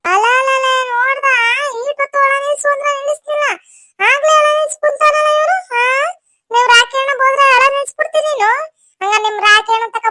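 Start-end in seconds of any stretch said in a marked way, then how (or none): 0.0-8.5 put-on high-pitched teasing baby voice; mock-scold in a high sing-song coo; playful baby talk. Telling a crying baby off, high baby talk.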